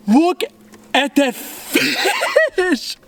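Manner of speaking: high, drawn-out amazement; awestruck. Real, not acted. Angler shows viewers the fish, awestruck. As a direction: stretch it right out, pitch soaring.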